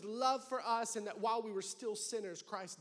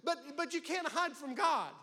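usual speaking voice

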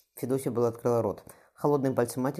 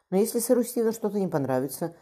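anxious, higher question; worried question; 9 semitones higher than usual. A narrator's reading. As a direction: ask fretfully about the groom. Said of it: "озабоченно спросила Федосья". (дочь тревожно спрашивает мать о женихе)

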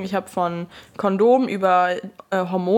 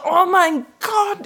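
mock scream; 10 semitones higher, 4 dB louder, much slower than usual. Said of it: "so eine Frau schreien gehört".

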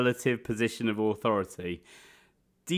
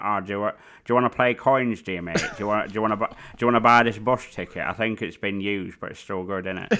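fast Devon-tinged chav voice; chav northern impression; 2 semitones lower, faster than usual. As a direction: rattle it off like a cheeky chav. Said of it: "into Devo".

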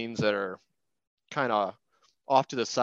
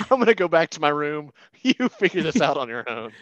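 higher, louder, through laughter; joking panicked parent, amused and loud; laughing mock refusal; 5 semitones higher, 7 dB louder than usual. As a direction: play the alarmed parent backing out, laughing. Joking parent backing away from child.

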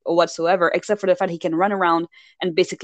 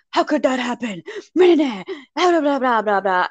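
higher mocking babble; mock fretting; 6 semitones higher than usual. Retelling Edward's reaction to the pregnancy, mock fretting. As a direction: rattle it off as a dismissive imitation.

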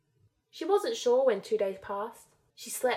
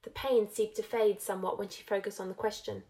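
ordinary delivery

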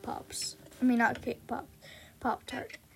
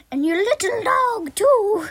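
high, loud cackling-villain style taunt; mock villain threat; 9 semitones higher, 9 dB louder than usual. Taunting the fangirls, high loud mock-villain threat. Witch quote, acted threat.

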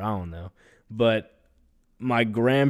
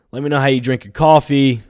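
asking the audience again, raised and loud; louder call-out; 5 semitones higher, 11 dB louder than usual. Real, not acted. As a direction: lift the question up and out loud. Repeats the coffee question louder.

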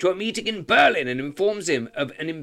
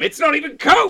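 shouting; 9 semitones higher, 6 dB louder than usual. Real, not acted. Correcting the joke loudly.

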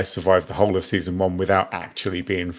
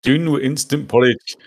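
bad Scottish accent; 5 semitones higher than usual. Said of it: "That sounded more Indian, really, than anything".

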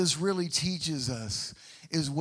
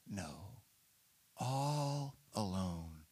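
hushed and slow; 7 semitones lower, 9 dB quieter, much slower than usual. Acted. Pastor dwells on David abandoned.

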